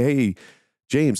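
usual speaking voice

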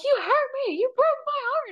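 very high mock-wounded wail; hammed-up hurt, pitched sky-high; dramatic heartbreak. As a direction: play the heartbroken drama queen. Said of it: "I'm dramatic".

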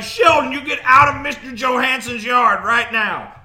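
loud, sharp parental scolding. Retold or imitated. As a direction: snap at the kid across the yard. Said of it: "He's like".

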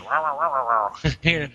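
replaying the same muddy wow wow sound; garbled recording mimic. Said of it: "No, listen again".

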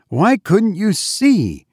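slow, high-pitched interrogation; demanding. A narrator's reading. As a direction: press the maid sternly for an answer. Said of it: "demanded her mistress".

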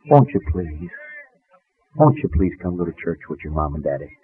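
low, soft maternal pleading; hushed plea. Retold or imitated. Mother asking her son to come to church, hushed plea. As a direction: plead gently, dropping very low and quiet.

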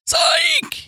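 teacher shouting psych, squeaky and hammed-up; mock gotcha shout. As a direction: yelp it like a prank reveal. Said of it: "She'll be like".

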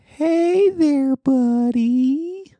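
put-on very high, sing-song baby talk; pet voice. Shown as a demonstration. Co-host mocks talking to a pet, squeaky high. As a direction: coo it up high like greeting a puppy. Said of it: "like, like".

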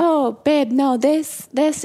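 high, insistent retold reassurance; reassuring father. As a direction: imitate a dad urgently comforting his child. Father answering his crying daughter.

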